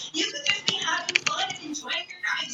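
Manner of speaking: urgent whispered plea. Retold or imitated. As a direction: hiss it under your breath to a friend. Urging friend in whisper.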